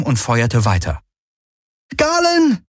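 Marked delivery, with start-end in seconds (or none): 2.0-2.6 sudden high-pitched cry; schreien. A narrator's reading. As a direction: cry the name out in alarm, very high. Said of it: "schrie Kirai plötzlich". (plötzlicher hoher Schrei)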